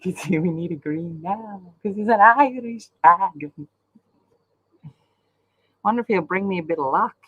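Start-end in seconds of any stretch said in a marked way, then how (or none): 0.0-3.7 put-on broad Aussie bogan accent; hammed-up Australian bogan voice. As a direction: put on a jokey bogan twang. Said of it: "except for Bogan".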